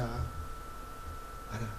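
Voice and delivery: unvoiced whisper trailing off; whispered echo. Preacher as God whispering to the praying pastor, unvoiced. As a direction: breathe it like a secret.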